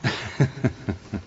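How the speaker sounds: a short, gentle chuckle; soft laugh. Acted. Mentor laughs softly at Camilo's naive question.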